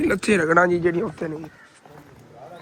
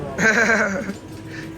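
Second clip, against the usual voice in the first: real chuckling laugh; 3 semitones higher than usual. Real, not acted. Vlogger joking with friends over breakfast, laughing.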